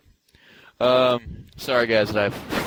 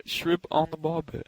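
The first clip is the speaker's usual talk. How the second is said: higher, slow attempt at an Australian accent; tentative Australian accent, drawn out and pitched up; 7 semitones higher, 3 dB quieter than usual. Shown as a demonstration. Cohost trying Australian as a stand-in for British, hesitant Australian accent. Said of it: "You can do it like Australian".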